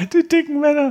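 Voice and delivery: hoch, lachend herausgeprustet. Real, not acted. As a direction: lach die Worte heraus. Lacht über Versprecher.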